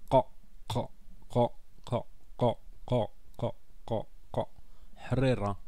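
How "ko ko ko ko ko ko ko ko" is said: ko from the back of the throat; guttural k. Shown as a demonstration. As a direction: repeat ko with a deep throat k. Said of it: "Savoir aussi dire le".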